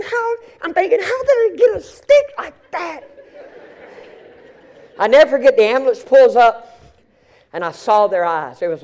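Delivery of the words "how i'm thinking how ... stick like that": high, breaking disbelief with a laugh; half-laughing, half-crying at the sight. Retold or imitated. Preacher recalling the stick in the boy's throat, high and incredulous.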